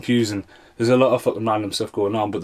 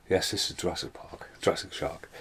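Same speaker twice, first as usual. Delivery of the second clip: whispered self-correction; whispered aside; 3 semitones lower, 11 dB quieter than usual. Real, not acted. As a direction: mutter it under the breath. Muttered aside correcting title.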